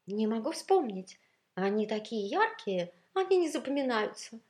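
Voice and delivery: high, plaintive admission; mildly distressed, refined lament; dejected. A narrator's reading. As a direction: answer helplessly, downcast. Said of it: "удручённо отвечала изысканная".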